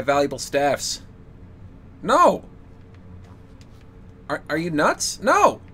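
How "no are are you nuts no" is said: high, loud indignant cry; hammed-up outrage at the request; outraged no. Real, not acted. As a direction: shriek the refusal in mock horror.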